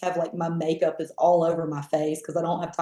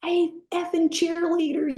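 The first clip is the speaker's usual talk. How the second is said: mock drill sergeant yell, pitched high; retold yell; 12 semitones higher than usual. Drill sergeant yells at the new recruit, mock angry. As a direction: imitate a barking drill sergeant. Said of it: "yelling and screaming".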